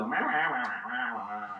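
muffled trombone-like wah wah, fading; Charlie Brown teacher wah. Retold or imitated. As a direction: mimic the cartoon adult's voice. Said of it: "they would have the teacher on the phone".

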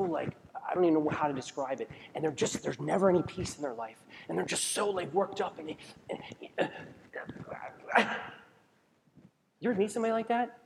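ordinary delivery

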